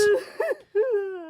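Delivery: shrill laughing squeal; high squealing laugh. Real, not acted.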